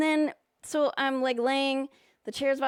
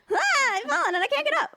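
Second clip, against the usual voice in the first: high, fast mock old-lady wail; hammed-up elderly cry for help; old person; 4 dB louder, faster than usual. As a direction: squeak it out like a helpless old person. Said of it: "I'm that fucking old person".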